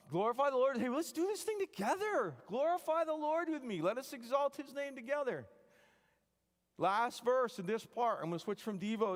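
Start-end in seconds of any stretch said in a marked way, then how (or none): 0.1-5.5 high, loud rallying praise; rousing call. Real, not acted. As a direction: lift the room into worship together.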